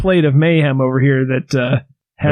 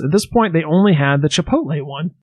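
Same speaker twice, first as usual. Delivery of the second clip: normal talking voice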